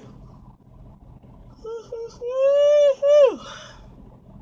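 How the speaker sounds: big, high, shouting laugh; loud burst of laughter. Real, not acted. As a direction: howl with laughter. Sudden loud laugh after complaint.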